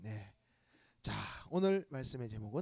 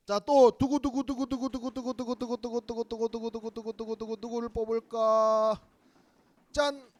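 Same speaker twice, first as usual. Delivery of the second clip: drumroll; 6 semitones higher, 7 dB louder than usual. Acted. Second drumroll for the draw.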